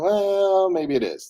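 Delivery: long high drawn-out well, sliding down; reluctant second thought. Retold or imitated. Talks self round to doubt.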